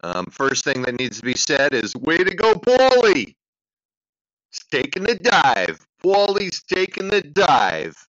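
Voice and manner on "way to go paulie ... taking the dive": put-on hollered East Coast accent, pitched way up on the name. Congratulating Paulie on taking the dive, East Coast accent. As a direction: play up an East Coast accent, high and drawn out. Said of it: "That's an East Coast accent for ya".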